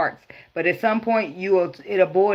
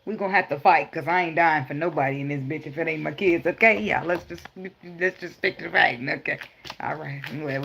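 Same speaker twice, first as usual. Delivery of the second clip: ordinary talk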